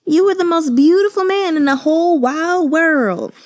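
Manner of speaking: begging him to believe he's beautiful; earnest declaration. Retold or imitated. Morjan insisting to Chuwaneng he is beautiful, high and impassioned. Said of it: "And Morjan's like".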